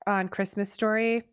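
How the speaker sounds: ordinary talk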